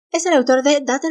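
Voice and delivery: ordinary talk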